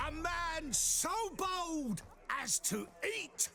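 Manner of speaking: loud, high barker calling the crowd; booming carnival announcer voice; showman's announcement. Acted. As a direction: proclaim it like a fairground showman. Fire eater announcing to crowd.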